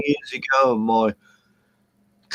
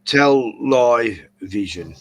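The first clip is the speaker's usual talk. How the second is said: slow pun; much slower than usual. Shown as a demonstration. Pun on television.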